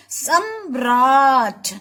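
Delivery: सम्राट sung out long and slow for the class; drawn-out demo. Shown as a demonstration. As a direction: slowly draw out सम्राट so the र can be heard. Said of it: "आवाज से समझ में आ रहा है ना आपको".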